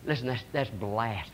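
soft grave whisper to the congregation. Real, not acted. Preacher hushes on blasphemy.

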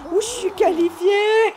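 loud, slow, high cry of joy; real thrilled whoop; excited cheer. Real, not acted. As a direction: shout out the win, delighted. Qualifying in the game.

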